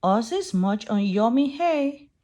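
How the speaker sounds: ordinary delivery, nothing marked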